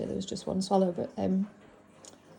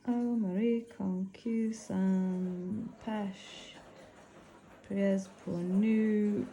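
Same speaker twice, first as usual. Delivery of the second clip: slow French with a northern English accent; much slower than usual. Real, not acted. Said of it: "I've got such a northern accent when I try to say French".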